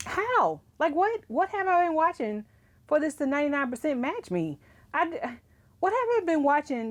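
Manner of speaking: high, baffled disbelief; how on earth, voice shooting up; incredulous. Real, not acted. Baffled by Netflix match.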